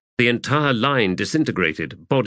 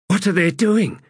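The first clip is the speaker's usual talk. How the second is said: high-pitched, insistent demand; 10 semitones higher, 3 dB louder than usual. A narrator's reading. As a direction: demand an answer, voice pitched well up. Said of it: "demanded Stalock".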